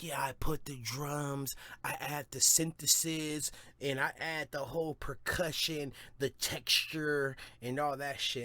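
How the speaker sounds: mock him by stretching the technical terms; mocking beatmaker impression. Rapper imitating the beatmaker listing his production, mocking. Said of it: "He was like".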